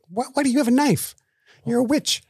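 high incredulous squeal; exasperated. Real, not acted. Co-host ranting about Bellatrix's knife, high and baffled. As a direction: squeal it in disbelief.

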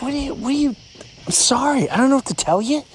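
high-pitched, mock-helpless exasperation; exasperated attitude. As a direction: throw up your hands at the critics. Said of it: "And that's my attitude".

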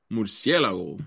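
Murciélago said with full Spanish sounds; Spanish pronunciation. Shown as a demonstration. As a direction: relish each syllable of Murciélago. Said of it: "such a fun word".

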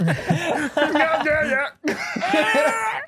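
high giggling yeahs; laughing. Real, not acted. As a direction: laugh through the words.

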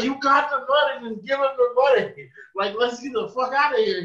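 high, impatient urging; retold exasperation. Bystander retelling a plea to end the argument, exasperated.

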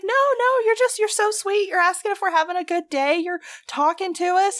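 very high, insistent mock gushing; gushing newcomers. New York couple insisting the worker is sweet, mock gushing. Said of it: "And they were like".

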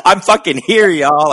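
high, excited fan cheer; Leslie Jones. Retold or imitated. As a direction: imitate a thrilled guest announcing their arrival. Voices Leslie Jones's delight filming herself.

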